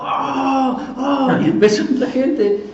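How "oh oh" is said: high, drawn-out mock "oh"; imitated crowd cheer. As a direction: act out a crowd's excited outcry. Crowd's reaction, acted out.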